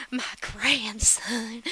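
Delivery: slow, breathy mock adoration; imitated doting grandmother. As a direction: draw it out like an awed grandma. Voices Constance receiving the baby.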